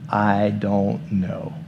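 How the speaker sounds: soft, very slow drawn-out I don't know; slow shrug. Retold or imitated. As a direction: let it hang, unsure. File folder label, drawn out.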